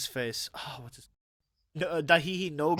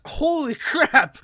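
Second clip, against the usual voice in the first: slow, louder and higher, with a laugh; mock amazed; 9 semitones higher, 7 dB louder, much slower than usual. Real, not acted. Co-host hyping the second show to viewers, mock amazed. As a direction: drag out holy crap in mock amazement.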